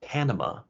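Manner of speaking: Panama with mixed English vowels, "PAN-uh-muh"; English pronunciation. Shown as a demonstration. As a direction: say Panama the American way. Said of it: "when we pronounce the name of Panama in English".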